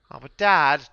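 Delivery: loud, high protest to Dad; mock child's complaint. Boy protesting to his father.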